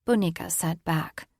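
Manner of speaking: plain reading voice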